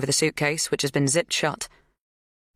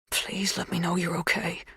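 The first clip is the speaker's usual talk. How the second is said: soft, voiceless plea; pleading whisper; 3 semitones higher, 5 dB quieter than usual. A narrator's reading. Dan at the window begging Kate, whispering. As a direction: whisper it into the glass. Forehead on glass, pleading softly.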